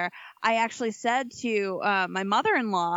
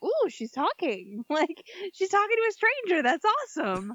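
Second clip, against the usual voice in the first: delighted surprise; 8 semitones higher than usual. Retold or imitated. Mom recalls shy child talking to a stranger, delighted surprise. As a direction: imitate a thrilled parent, pitched up.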